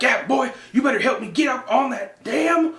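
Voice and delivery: dad yelling at his son to pull him up, high mock shout; bossy dad shout. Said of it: "what really made me laugh is he's like".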